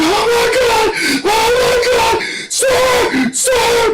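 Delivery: high frantic shrieks for help; panicked screaming. Retold or imitated. Nerdy recruit shrieking at the drill instructor in panic. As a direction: scream for the sir in terror. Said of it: "freaking out, screaming".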